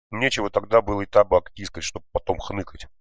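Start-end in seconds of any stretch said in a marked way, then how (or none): none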